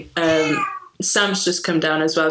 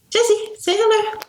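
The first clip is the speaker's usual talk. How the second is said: high, sing-song pet voice; coaxing a pet; 14 semitones higher than usual. Real, not acted. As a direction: coax the cat sweetly. Coaxing cat to greet.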